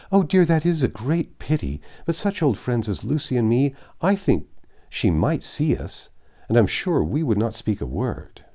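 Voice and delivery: high, loud gushing plea; pushy Miss Steele. A narrator's reading. Miss Steele insisting to Eleanor on a visit, high and gushing. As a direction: press eagerly to see her.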